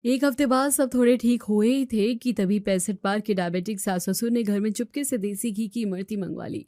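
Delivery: धीमी, गहरी कथावाचक आवाज़; दृश्य बताते हुए शांत नैरेशन; कहानी सुनाना. A narrator's reading. Story narrator between scenes. (कथावाचक की शांत आवाज़)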